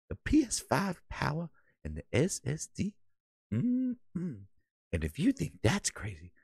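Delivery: sulky console architect muttering low; mock Mark Cerny. Streamer voices a cussing console architect, low and soft. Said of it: "Cerny, calm down".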